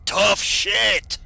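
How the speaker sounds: loud, high defiant yell. Acted. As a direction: bellow it out in defiance. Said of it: "Dark would have yelled".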